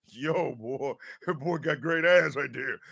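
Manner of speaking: lightly voiced guy checking himself out; quietly impressed self-admiring aside; mock admiring. Imitating himself admiring video.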